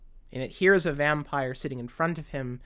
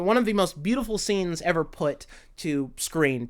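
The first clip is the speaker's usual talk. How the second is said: ordinary talk, nothing marked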